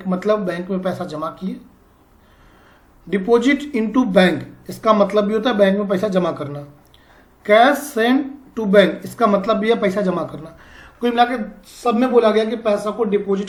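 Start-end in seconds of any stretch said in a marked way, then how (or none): 3.1-4.4 slow, carefully spaced dictation. Shown as a demonstration. Teacher dictating to students with pen and copy, slowly. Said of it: "मैं आराम आराम से बोलूँगा, धीरे बोलूँगा". 7.5-9.0 slow, carefully spaced dictation. Shown as a demonstration. Teacher dictating to students with pen and copy, slowly. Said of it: "मैं आराम आराम से बोलूँगा, धीरे बोलूँगा".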